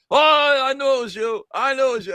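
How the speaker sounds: high mock shout in a thick Russian accent; Nikolai voice. Friend realising the prank, high shouted Nikolai voice. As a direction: bellow it like the caught-out Russian. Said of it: "He's like".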